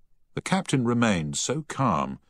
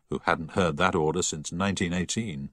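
ordinary delivery, nothing marked

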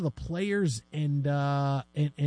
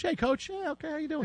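mock greeting; 9 semitones higher, 6 dB quieter than usual. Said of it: "They'll get called coach".

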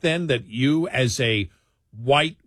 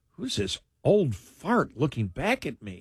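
slow, softer mock self-talk; mock dismay; 2 semitones higher, 5 dB quieter than usual. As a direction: puzzle slowly at your own reflection, playfully. Mirror self-talk about ageing.

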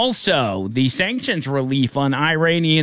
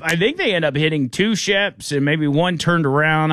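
ordinary delivery

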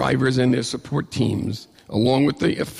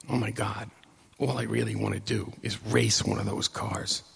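soft, low-key musing; quiet inner thought; 3 semitones lower, 7 dB quieter than usual. Retold or imitated. Young fan wishing to himself in the pit, quiet musing. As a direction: say it softly, as if thinking to yourself.

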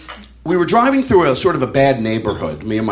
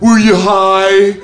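long drawn-out, louder mock question; 3 semitones higher, 5 dB louder, much slower than usual. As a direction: stretch every word to tease him. Friends question him, drawn out.

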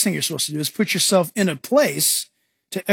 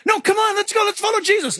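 high, urgent invitation; rally the others to go; mock-eager Peter; 11 semitones higher than usual.

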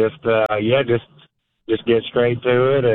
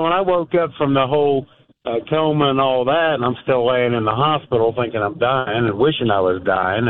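usual speaking voice

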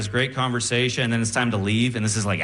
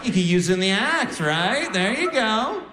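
very high cackling cartoon voice; squeaky laughing toy-box mascot; goofy box guy; slower than usual. The juggling-kit mascot laughing at the comedian, squeaky and high.

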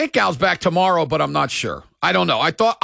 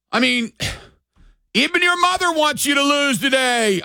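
ordinary talk, nothing marked

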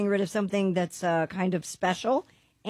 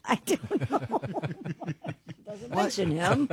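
laughing. Real, not acted. Laughing at Epstein joke.

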